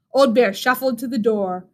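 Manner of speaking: ordinary delivery, nothing marked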